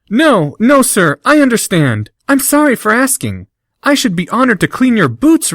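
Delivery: high, gushing fawning voice; fawning flattery. Acted. Harry gushing over Malfoy, fawning and high. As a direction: lay the flattery on thick.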